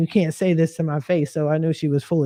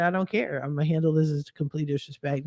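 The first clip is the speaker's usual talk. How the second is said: quietly determined; 2 semitones lower, 7 dB quieter, faster than usual. Retold or imitated. Retold firm statement to mother.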